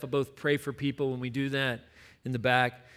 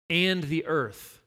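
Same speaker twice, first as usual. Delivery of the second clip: ordinary talk, nothing marked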